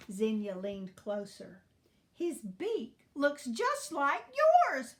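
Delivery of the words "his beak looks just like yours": high, loud, slow gushing; declaring. A narrator's reading. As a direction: declare it with slow, swooping delight. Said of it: "she declared".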